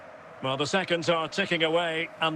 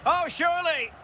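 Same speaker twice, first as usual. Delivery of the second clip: high-pitched outburst at a chance; sudden high commentator's cry; excited disbelief; 10 semitones higher than usual. Pretend. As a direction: exclaim as if a goal is certain. Commentator reacting to a near goal.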